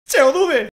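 high loud hopeful call; excited high cry. Real, not acted. Hoping for a Pokémon.

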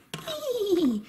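high, playful whinny; toy horse neigh. Pretend. Toy horse answers in play.

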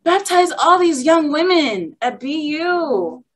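high-pitched hammed-up wail; struggling protest. Disciple begging God not to take her girls, high mock wail. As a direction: protest like a distraught child, stretching words. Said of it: "I was struggling".

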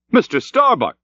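high hail to a shipmate; crying out. A narrator's reading. Stubb hails Starbuck's boat, crying out. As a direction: call his name across the water. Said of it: "cried Stubb".

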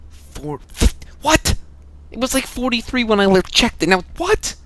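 high-pitched shocked squeak; real disbelief at the damage. Real, not acted. Damage number jumps suddenly.

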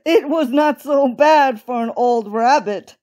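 pleased chuckle, then modest boast; chuckling. A narrator's reading. Bunny gentleman after his jump, self-satisfied chuckle. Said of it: "chuckled the bunny gentleman".

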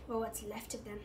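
quiet nasty aside. A narrator's reading. Caretaker leaves students with gamekeeper, quietly nasty. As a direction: add it softly, with a touch of malice. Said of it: "he added nastily".

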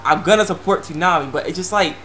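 usual speaking voice

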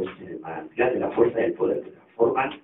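low, soft, unimpressed repeat; quiet, low-pitched deadpan; deadpan echo. Acted. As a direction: repeat it under your breath, unimpressed. Stanley flatly echoes the proclamation.

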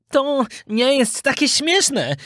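slow, high-pitched retort; unamused schoolfriend. A narrator's reading. Knot with donkey ears himself, unamused. Knot, also eared, objects.

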